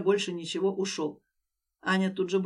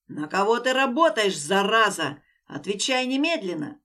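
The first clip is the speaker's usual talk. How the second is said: loud furious yell; 5 dB louder than usual. Acted. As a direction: scream it in her face. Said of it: "Заорал ей в лицо Дэн".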